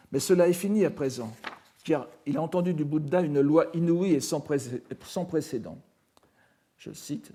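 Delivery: ordinary talk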